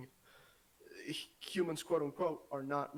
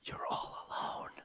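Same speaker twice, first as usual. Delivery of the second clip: whisper; 11 semitones lower than usual. Acted. Friend unmuted, spooky joke.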